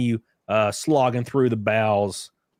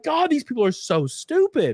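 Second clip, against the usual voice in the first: exasperated; 12 semitones higher than usual. Retold or imitated. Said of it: "I'm like".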